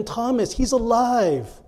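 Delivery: slow, drawn-out pleading; earnest, stretched-out appeal; trying hard to persuade. Acted. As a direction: implore a friend to believe, starting high. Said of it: "They were trying so hard to persuade him".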